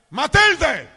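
husband yelling his wife's name; loud, high mock-husband holler; acted shout. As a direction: call to her at full volume, high. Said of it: "parece que estamos gritando".

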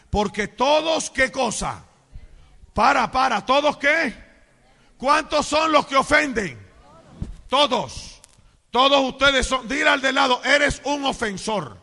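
loud, high pulpit exhortation; booming call-and-response preacher; full-force preaching. Acted. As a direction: rouse the congregation to answer back. Preaches, drawing responses from congregation.

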